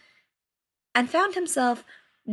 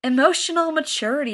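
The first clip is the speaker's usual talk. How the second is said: eye-rolling; 6 semitones higher, 5 dB louder than usual. Acted. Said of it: "Rolling his eyes".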